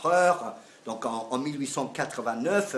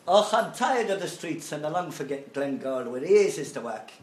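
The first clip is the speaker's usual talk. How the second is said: lyrics read aloud in a put-on Irish brogue; Irish accent; 5 semitones higher, 5 dB louder than usual. Lecturer reads Paddy's lament to class in an Irish accent. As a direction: lean into an Irish lilt. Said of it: "mon accent irlandais".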